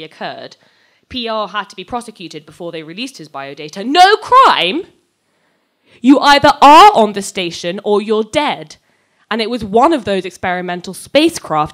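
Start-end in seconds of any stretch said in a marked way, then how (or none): 3.8-4.9 much higher and louder, disbelieving; incredulous outburst. Acted. Heated argument over data. 6.0-11.7 much louder, pressing the point; heated insistence. Acted. Heated argument over data.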